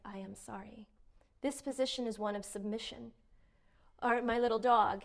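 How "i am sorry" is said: much softer, sinking into a meek apology; lowered voice. Shown as a demonstration. Teaching viewers how a submissive apology sounds, soft and low. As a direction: shrink down and murmur it. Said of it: "we lower our voice".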